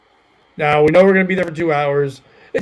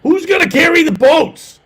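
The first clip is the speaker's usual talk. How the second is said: higher, louder yelled echo; Goggins impression; 11 semitones higher, 6 dB louder than usual. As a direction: shout it back, hammed up. Echoing the Goggins shout.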